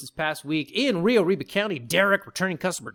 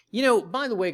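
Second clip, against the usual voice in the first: ordinary talk, nothing marked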